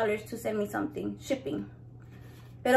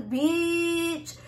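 usual speaking voice

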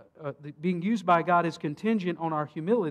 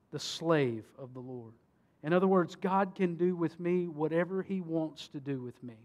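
normal talking voice

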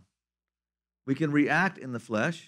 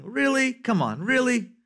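incredulous; 8 semitones higher than usual. Real, not acted. Incredulous at sleeping Jesus.

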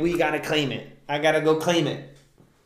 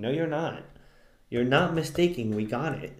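normal talking voice

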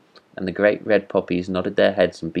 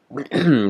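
pointed, annoyed throat clear; angry throat clearing; 7 semitones higher than usual. A narrator's reading. Rocket interrupting the chattering frog, huffy throat clear. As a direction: clear the throat to get a word in. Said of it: "said the Rocket angrily".

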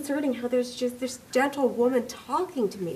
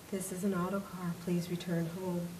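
soft, low, near-whispered instruction; calm facility voice; 3 semitones lower, 13 dB quieter than usual. Acted. The building's voice tells Mary to return home, soft and low. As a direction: quietly insist she go home.

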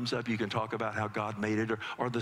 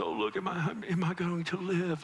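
higher, whiny little boy, mildly hammed; dramatic child; 7 semitones higher than usual. Little son on father's lap, mock dramatic whine. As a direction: play the hurt son, overdoing it. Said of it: "kids are very dramatic".

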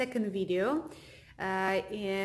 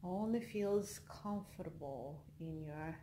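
soft, unhurried meditation voice with long pauses; guided meditation; 9 dB quieter than usual. Acted. Leads a yoga nidra relaxation.

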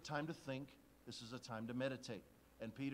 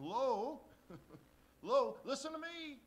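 much higher, louder, slow call for attention; Peter butting in; 11 semitones higher, 11 dB louder, slower than usual. Acted. Peter interrupting Jesus to be heard, loud and high. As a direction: cut in like Peter demanding a turn.

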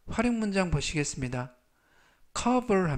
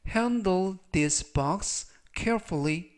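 handle this box carefully read very slowly, word by word; teacher reading the example sentence very slowly; very slow model reading; 3 semitones higher, much slower than usual. Shown as a demonstration. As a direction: read it slowly for learners to copy. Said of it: "즉 전체적 의미는".

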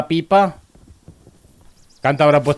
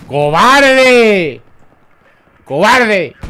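loud, high furious yell; yelling coward; 9 semitones higher, 11 dB louder, much slower than usual. Real, not acted. Streamer yelling at the camping enemy, furious shout. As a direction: scream it at the camper, full force.

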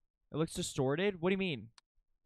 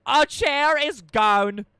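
louder, slow, higher call-out; loud announcing; 7 semitones higher, 13 dB louder, slower than usual. Real, not acted. Spots anomaly, announces loudly.

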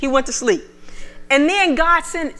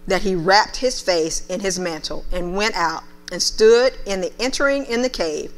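lower, measured Bible reading voice; preacher reading the King James to the church; scripture reading; 5 semitones lower than usual. Acted. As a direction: read the passage with weight. Reads 1 Kings 19 aloud.

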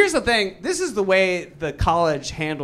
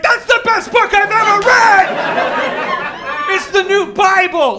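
high, loud hammed-up fan yell; crazed fan; 13 semitones higher, 5 dB louder, slower than usual. Whole country raving about the book, high frenzied shout. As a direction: rave at the top of your voice. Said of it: "everyone in the country is like".

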